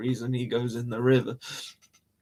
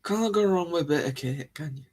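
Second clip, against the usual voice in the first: ordinary talk, nothing marked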